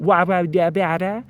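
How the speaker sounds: loud, quick wah-da babble; nonsense babble. Shown as a demonstration. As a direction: rattle off loud nonsense syllables. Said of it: "That isn't even baby talk".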